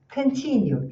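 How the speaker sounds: Continue with a tense U at the end; correct pronunciation. Shown as a demonstration. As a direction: model Continue, ending on a tight U. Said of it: "E aqui observe a pronúncia". (Continue com U tenso no final)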